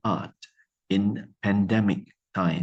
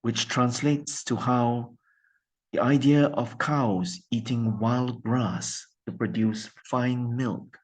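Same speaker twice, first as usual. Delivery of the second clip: ordinary talk, nothing marked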